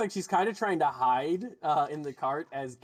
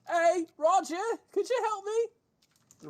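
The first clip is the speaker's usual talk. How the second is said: higher, slow drawn-out call for help; the guard, higher and slow, begging Roger for a hand; 14 semitones higher, 4 dB louder, slower than usual. Acted. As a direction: call to a coworker, pleading. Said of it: "He's like".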